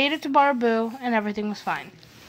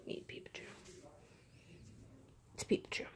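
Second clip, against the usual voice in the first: hushed whispered introduction; 9 semitones lower, 22 dB quieter, faster than usual. Real, not acted. Introducing the mascot toy to viewers in a whisper.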